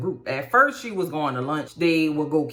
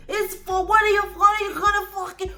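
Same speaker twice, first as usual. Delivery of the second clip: put-on hollering old man; 12 semitones higher than usual. Steve hollering at Brady over the pregnancy, hammed-up rage. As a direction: keep yelling at the boy in disbelief. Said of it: "He was mad. He was hollering".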